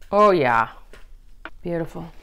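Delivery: ordinary talk, nothing marked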